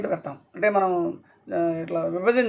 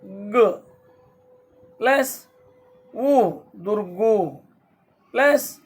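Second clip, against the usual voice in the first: slow drawn-out syllables; much slower than usual. Shown as a demonstration. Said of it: "దుర్ర్".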